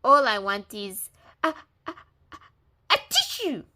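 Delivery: wolf about to sneeze; sneeze building. A narrator's reading. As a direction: let the sneeze build on each a.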